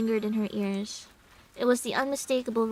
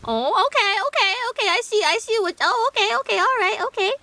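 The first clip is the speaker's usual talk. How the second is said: high, loud put-on voice; a high cartoonish voice, flustered; high character voice; 9 semitones higher, 6 dB louder, faster than usual. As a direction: chirp it out in a squeaky voice. Voicing a figure, high and loud.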